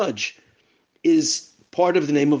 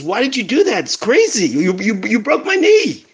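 pained outcry; 6 semitones higher than usual. Retold or imitated. Injured man cries out after attack.

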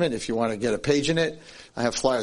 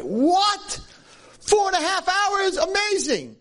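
much higher, stunned and delighted; the girl's father can't believe his ears, slow on the hours; amazed father; slower than usual. Retold or imitated. Father hears the date lasted hours, amazed and high.

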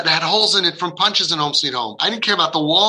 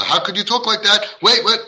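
higher, flustered parent imitation; indignant reproach; 6 semitones higher than usual. Parent to cursing child, acted indignant reproach. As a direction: act out a shocked parental rebuke.

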